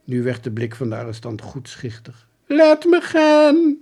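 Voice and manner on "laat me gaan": high, loud, slow begging; pleading soldier. Acted. Soldier on his knees begging the detective, slow and high. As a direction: beg slowly, high and loud. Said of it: "riep hij en hij viel smekend".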